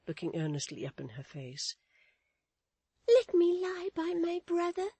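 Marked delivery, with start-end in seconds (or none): 3.1-4.9 put-on child voice. Child appeals to her nurse, pleading child voice. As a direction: plead as a small child, pitched way up. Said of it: "said the child".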